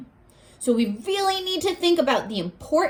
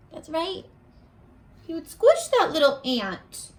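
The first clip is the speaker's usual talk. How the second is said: ordinary delivery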